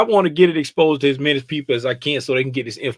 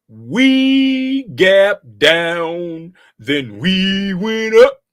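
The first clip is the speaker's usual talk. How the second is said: slow sing-song recap; 4 dB louder, much slower than usual. Pretend. Mock dramatic chart recap.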